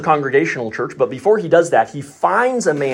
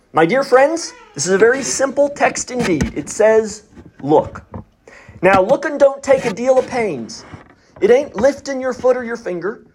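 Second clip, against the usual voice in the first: put-on a lay preacher's thick rural Essex accent, pitched higher and earnest; thick country accent; 7 semitones higher than usual. Uneducated preacher urging a snowbound congregation, thick Essex country accent. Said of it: "with a very thick country accent".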